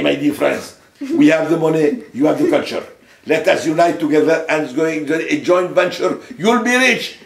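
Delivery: mock oratory in Arabic-accented English, loud and high; hammed-up Arabic-accented speech, rising at the end. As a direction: address a hall with a put-on Arabic accent. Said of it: "j'aurais fait un discours à la Chambre de commerce".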